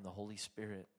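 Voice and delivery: ordinary delivery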